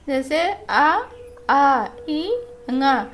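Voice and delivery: teacher demonstrates nasal vowels to students. Shown as a demonstration. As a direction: push air through mouth and nose on "आ, ई, ङा". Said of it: "जो अनुनासिक होते हैं".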